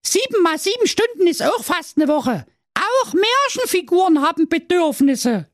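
very high, cheeky princess voice. Acted. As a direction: justify herself with cheek. (sehr hoch und frech)

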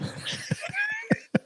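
breathy high-pitched giggle. Real, not acted. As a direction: giggle at your own joke. Laughs at own tipsiness.

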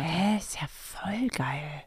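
slow whispered remark; whispered aside. Real, not acted. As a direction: breathe it out slowly. Comentario susurrado.

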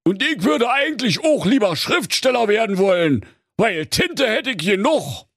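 very high octopus character; eager octopus. Acted. Octopus states his wish. (Tintenfisch, hohe Figurenstimme)

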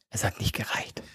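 whispered secret; confiding whisper. Real, not acted. Host confides the embarrassing detail to his co-host, whispering. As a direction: drop to a whisper between friends. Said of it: "Aber unter uns".

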